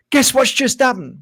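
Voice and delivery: high-pitched, hammed-up announcement; playfully overexcited, pitched right up; mock excited news. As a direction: imitate someone bursting with news. Acts out someone bringing big news.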